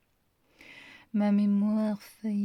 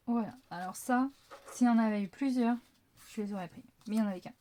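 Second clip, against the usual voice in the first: ordinary talk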